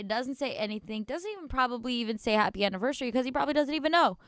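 normal talking voice

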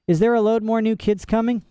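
put-on high, pushy girl; demanding. A narrator's reading. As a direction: demand it bluntly. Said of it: "demanded one of the girls".

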